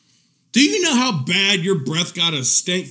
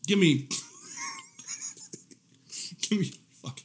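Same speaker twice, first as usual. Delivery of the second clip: laughing whisper; 2 semitones higher, 12 dB quieter, faster than usual. Real, not acted. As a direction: whisper it with a giggle. Whispering for his cat prop.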